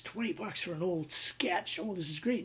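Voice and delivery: gleeful thought. Retold or imitated. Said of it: "The guy thinks".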